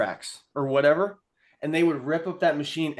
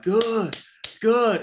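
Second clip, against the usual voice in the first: coach's praise; 6 semitones higher, 3 dB louder than usual. Retold or imitated. Mimicking coordinator calling out praise.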